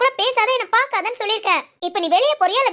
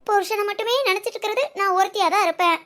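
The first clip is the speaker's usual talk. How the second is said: ordinary delivery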